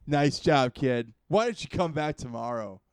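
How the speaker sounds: put-on loud, patronizing old master. Drunk master sends the hero away again, loud and patronizing. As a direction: praise him like a lazy sensei stalling.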